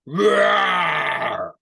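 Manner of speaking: long loud bellowing roar; loud lion roar. Acted. Lion roaring into the well, loud bellow. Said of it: "he roared a loud roar".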